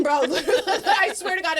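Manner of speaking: breaking into laughter, high and loud; laughing. Real, not acted. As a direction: swear it through a real laugh. Laughing while telling the story.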